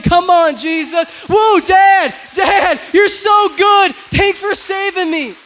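loud high joyful shouting to God. Pretend. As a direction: whoop it out like a happy child to Dad. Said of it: "We should be running saying".

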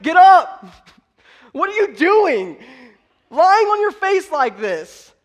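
high, slow, drawn-out holler; booming, incredulous acted reprimand; shouted rebuke. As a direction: stretch each word into a loud scolding. Repeats God's shouted rebuke.

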